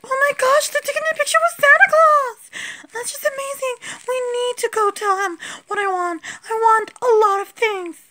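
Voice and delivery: put-on high toy-girl voice bursting with glee; squealing excited girl. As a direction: squeal with delight at seeing Santa. Doll spots Santa photo.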